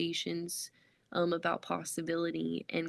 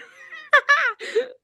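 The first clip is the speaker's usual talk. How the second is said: loud, high burst of laughter; real laugh, pitched way up; big laugh; 12 dB louder than usual. Real, not acted. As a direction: burst out laughing at your own story. Laughs at her own story.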